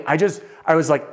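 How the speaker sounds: ordinary talk, nothing marked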